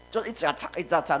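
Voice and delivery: ordinary talk, nothing marked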